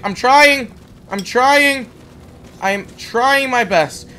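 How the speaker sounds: loud, high frustrated insistence; exasperated protest. Real, not acted. Player told to keep close, exasperated protest. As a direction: protest loudly, leaning hard on trying. Protesting to game companion's order.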